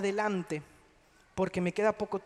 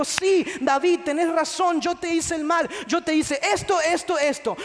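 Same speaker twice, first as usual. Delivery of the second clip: higher, louder, earnest confessing; mock confession; 5 semitones higher, 4 dB louder than usual. Saul admitting guilt to David, in a raised earnest voice. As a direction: confess openly, naming the wrong. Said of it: "Saúl no le dijo".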